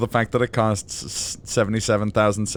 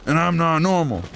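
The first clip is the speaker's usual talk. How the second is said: higher, emphatic wrestler promo voice; Scott Steiner; 6 semitones higher than usual. Acted. Wrestler working out the odds of beating him, higher promo voice. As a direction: rant the odds like a pro wrestler, pitched up. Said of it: "is Scott Steiner's math".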